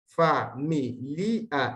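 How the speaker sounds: fa-mi-li-a, each syllable separated; syllable split demo. Shown as a demonstration. Teacher splitting familia into syllables for students. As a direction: familia broken into four syllables. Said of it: "la sílaba en cuestión es la li".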